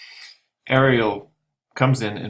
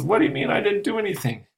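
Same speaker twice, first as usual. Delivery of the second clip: much higher, fast bewildered outcry; indignant protest; 11 semitones higher, faster than usual. Acted. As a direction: protest your innocence in disbelief. Trinculo protests innocence.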